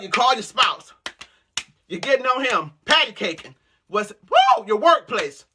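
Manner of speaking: ordinary talk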